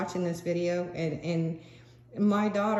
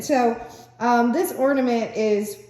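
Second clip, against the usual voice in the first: ordinary talk, nothing marked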